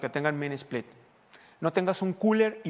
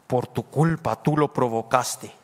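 a low, louder, slow accusing voice, lightly voiced; 4 semitones lower, 5 dB louder, slower than usual. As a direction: condemn the listener, slow and heavy. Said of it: "Ese es el diablo".

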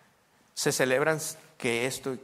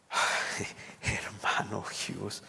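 imitated uneasy reaction; 4 semitones lower, 5 dB quieter, much slower than usual. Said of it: "Y ellos así".

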